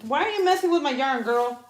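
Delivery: high, loud, fast telling off; loud scolding. Real, not acted. To the cat in her yarn, loud high scolding. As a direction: shoo the cat away sharply.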